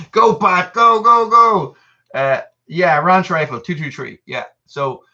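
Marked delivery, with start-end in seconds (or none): 0.1-1.8 high, loud cheer for Pat; root him on like a fan at the finish line. Real, not acted.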